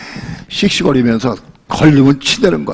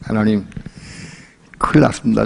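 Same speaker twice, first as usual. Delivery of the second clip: ordinary talk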